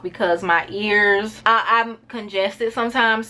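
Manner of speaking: usual speaking voice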